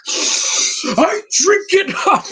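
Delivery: scream-cry it like a melodramatic preacher; mock overacted wail, stretched out; Paul Dano overacting. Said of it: "He's like".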